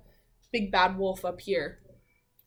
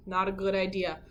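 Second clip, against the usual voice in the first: ordinary delivery, nothing marked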